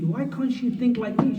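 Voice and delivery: mock a husband who wants a copy of himself. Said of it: "she can't be".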